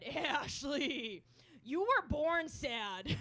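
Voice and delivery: loud, drawn-out imitation of dad; exasperated dad. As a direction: stretch the words out like a fed-up father. Acts out dad's blunt reply.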